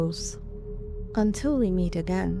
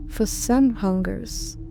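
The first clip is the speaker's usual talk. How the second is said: ordinary delivery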